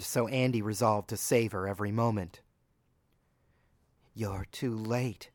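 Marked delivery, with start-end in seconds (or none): 4.1-5.3 quiet, slow, bitter reply. A narrator's reading. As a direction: let the bitterness smile through. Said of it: "she said with a bitter smile".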